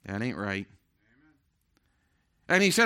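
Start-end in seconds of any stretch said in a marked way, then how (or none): 0.0-0.7 much softer, lower warning hum; a low, hushed voice of conscience; quiet inner voice. Retold or imitated. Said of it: "This voice said".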